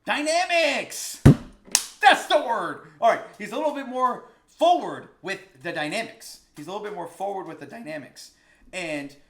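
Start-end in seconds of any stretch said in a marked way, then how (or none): 0.0-2.9 loud high triumphant cry, the first word drawn out slowly; eureka shout. Real, not acted. Teacher finally recalls the word he was hunting for, triumphant shout. 3.0-6.4 loud and high, still riding the high; triumphant. Real, not acted. Teacher restating his point after finding the word, loud and triumphant.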